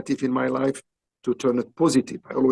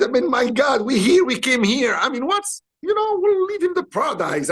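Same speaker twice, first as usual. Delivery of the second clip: much higher, eager sales pitch; coaxing his friend to stay, higher and excited; 10 semitones higher than usual. Retold or imitated. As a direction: talk him into it, rising with excitement. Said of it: "So I said".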